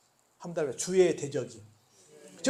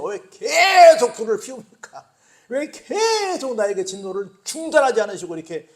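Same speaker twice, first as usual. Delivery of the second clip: protesting cry; 3 semitones higher, 9 dB louder than usual. Acted. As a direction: demand why the fire keeps burning. Psalmist arguing with God.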